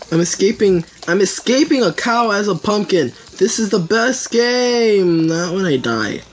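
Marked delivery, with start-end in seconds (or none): none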